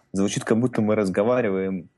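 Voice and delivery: normal talking voice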